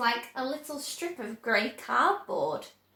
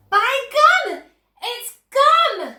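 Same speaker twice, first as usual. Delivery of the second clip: high, loud excited shriek; girl shrieking with excitement over gum; 12 semitones higher, 11 dB louder, slower than usual. A narrator's reading. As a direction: squeal it out at the top of her lungs. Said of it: "she shrieked".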